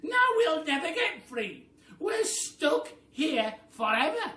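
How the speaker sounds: a wife wailing in despair; a loud, high, despairing cry; desperate Missus Twit. Acted. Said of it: "screamed Missus Twit".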